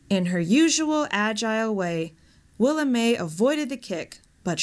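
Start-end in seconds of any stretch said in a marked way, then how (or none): none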